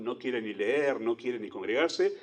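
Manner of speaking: usual speaking voice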